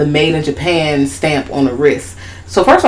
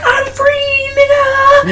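much higher, triumphant squeak; high gleeful cry; much slower than usual. Retold or imitated. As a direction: yell freedom like a tiny doll. Voicing Shitface escaping.

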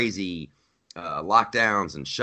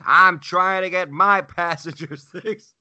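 high, slow, louder parroting; mocking echo; 9 semitones higher, 3 dB louder, slower than usual. Traveler throwing the worker's words back, mocking. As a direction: parrot it back slowly to mock him.

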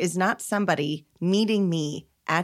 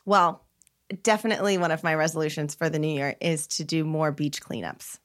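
ordinary talk

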